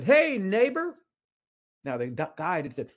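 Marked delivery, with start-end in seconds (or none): 0.0-1.0 high, loud friendly hail; wife calling out. Retold or imitated. Wife cheerfully hails her congressman, higher and louder. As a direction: call out cheerfully to a passing congressman. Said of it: "and she said".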